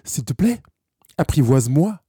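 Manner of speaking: prière plus haute, aux finales montantes; supplique de la colère. Acted. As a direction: demander instamment, la voix qui monte. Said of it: "une émotion de colère". (supplique pressante, sans crier)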